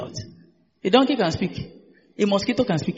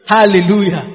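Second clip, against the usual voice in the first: calling out praise; 8 dB louder than usual. Real, not acted. Preacher rousing the congregation to praise, loud call. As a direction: send the word out to the congregation, loudly.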